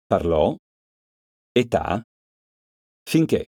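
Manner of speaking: Parlò, età, finché each hit hard on the last syllable; final-syllable stress. Shown as a demonstration. Teacher demonstrating final stress to pupils, last syllable hit. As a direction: land each word on its last vowel. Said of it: "L'accento cade sull'ultima sillaba".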